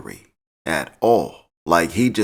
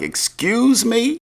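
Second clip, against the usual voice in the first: a drawn-out, much higher "Excuse me?" rising in mock outrage; indignant excuse me; 13 semitones higher, slower than usual. [name], affronted by Kane's mockery, asks in high, slow mock outrage. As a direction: stretch it high and slow, offended.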